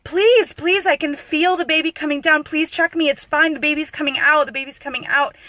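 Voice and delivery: high, loud, urgent pleading; desperate begging. Retold or imitated. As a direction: plead like a labouring mother, insistently. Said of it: "she was begging".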